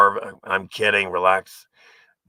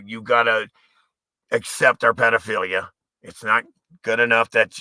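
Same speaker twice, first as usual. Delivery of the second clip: ordinary delivery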